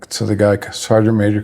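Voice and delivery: usual speaking voice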